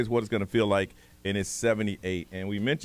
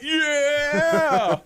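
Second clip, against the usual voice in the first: high, loud triumphant yeah; excited yell; 5 dB louder than usual. Retold or imitated. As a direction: cheer it like you just won. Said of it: "I'm like".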